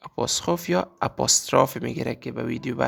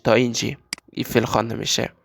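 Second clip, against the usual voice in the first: ordinary delivery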